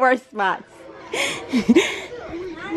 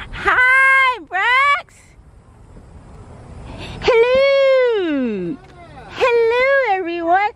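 excited greeting; 8 semitones higher, much slower than usual. Real, not acted. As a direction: call out a joyful welcome. Greeting family arriving at home.

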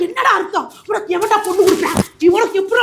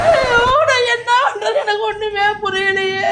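slow, tearful lament; hammed-up crying in exasperation; despairing wail. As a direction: wail helplessly, dragging out the words. Mother despairs over son in skit.

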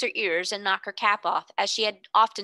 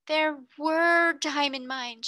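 slow, firm, higher declaration; stoutly; 6 semitones higher, much slower than usual. A narrator's reading. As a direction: state it stoutly, word by word. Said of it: "she said stoutly".

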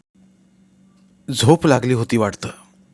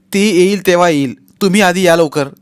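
loud, high pressing; urgent insistence; 7 semitones higher, 8 dB louder than usual. Acted. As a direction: demand he come now.

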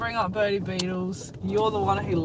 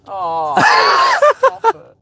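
loud, much higher laughing squeal; real laughing outcry; laughing shout; 13 dB louder, slower than usual. Real, not acted. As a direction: shriek with laughter.

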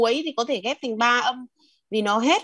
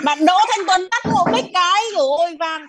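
high, raised-voice request to a classmate; calling out; 7 semitones higher, 3 dB louder than usual. Real, not acted. Student asking classmate to mute the mic, calling out high. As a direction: call across the call to get a mic muted.

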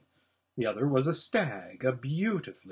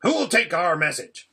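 louder, urgent dwarf cutting in; interrupting; 3 semitones higher, 7 dB louder than usual. A narrator's reading. Dwarf seeking a messenger, interrupting. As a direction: break in briskly over the chatter. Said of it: "interrupted the dwarf".

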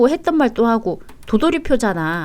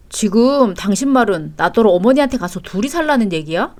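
usual speaking voice

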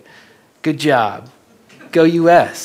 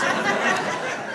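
breathy laugh; 7 dB quieter than usual. Real, not acted. Laughing at failed trap.

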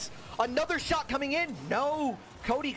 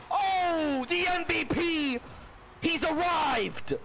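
crown the star player with a roar; excited shout; 6 semitones higher, much slower than usual. Real, not acted. Star player scores, commentator erupts.

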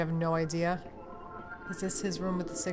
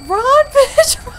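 very high, loud shriek; piercing high-pitched screamed warning; panicked scream; 17 dB louder, much slower than usual. Real, not acted. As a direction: scream it out in a fright. Frantic flight from the monster.

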